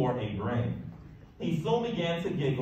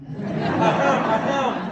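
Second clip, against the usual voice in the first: high, loud fake ahem with a laugh; throat-clearing cough; 7 semitones higher, 8 dB louder, slower than usual. A narrator's reading. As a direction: clear the throat to cover embarrassment. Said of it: "coughed Mister Lowe".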